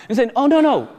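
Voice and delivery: usual speaking voice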